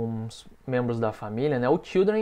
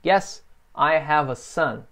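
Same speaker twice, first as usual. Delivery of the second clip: slow, clear repeat-after-me sentence; teacher modelling slowly; slow model answer; slower than usual. Shown as a demonstration. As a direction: say it slowly for students to copy. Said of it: "Repeat, ... repete comigo".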